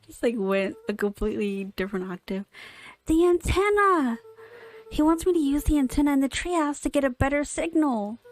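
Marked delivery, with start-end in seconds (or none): 3.1-8.2 excited realisation. Acted. Luca's sudden realisation.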